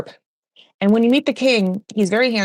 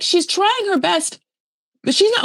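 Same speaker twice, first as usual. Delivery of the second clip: much higher, exaggerated repeat of an excuse; high-pitched mocking; 12 semitones higher than usual. Host mocking an excuse for Lisa, high-pitched. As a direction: push it way up in mock defense.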